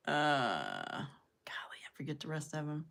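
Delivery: soft whispered aside to herself. Real, not acted. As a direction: whisper it as you give up recalling. Trailing off, forgetting team names.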